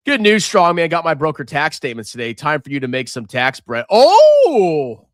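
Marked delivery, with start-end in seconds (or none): 3.9-5.1 excited. Real, not acted. Reacting to tax bread comment.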